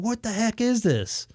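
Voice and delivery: retold indignant disbelief; pissed-off coworker. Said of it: "like, getting pissed".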